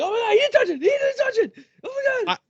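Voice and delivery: very high, loud excited squeal; giddy impression of co-host. Co-host imitating his partner's joy over the untouched title, giddy squeal. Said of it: "like, so giddy".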